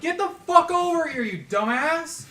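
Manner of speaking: high, loud yell across the room; shouting. Real, not acted. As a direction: call him over, yelling. Yelling for friend to come.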